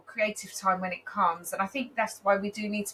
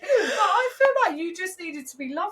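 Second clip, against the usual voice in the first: real teary laughter, voice rising high and loud; laughing through tears, much higher; emotional, tearing up; 9 semitones higher, 4 dB louder than usual. Real, not acted. Sister grateful for love shown to her baby, real teary laughter. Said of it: "It actually makes me feel quite emotional".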